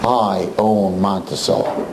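low, slow threat; a hint of a gangster boss, slow and low; menacing owner. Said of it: "That's what he tells us".

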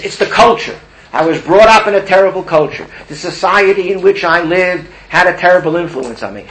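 loud and high, blaming the world; indignant excuse. Acted. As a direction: insist it's everyone's fault but his. Blaming society, acted plea.

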